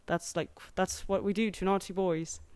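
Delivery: a policeman's stern British accent, lightly played. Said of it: "that was my English, British accent".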